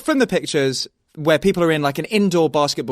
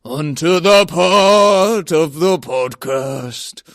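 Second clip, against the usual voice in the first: announcer voice; 5 dB louder, much slower than usual. Pretend. Announcing podcast segment.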